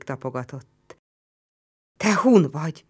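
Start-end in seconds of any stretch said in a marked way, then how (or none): 2.0-2.8 startled, louder question; frightened. A narrator's reading. Roman startled by the fur cloak. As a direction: jolt with sudden fear. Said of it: "ijedt el".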